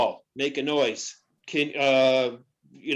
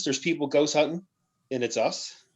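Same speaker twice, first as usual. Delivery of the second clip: normal talking voice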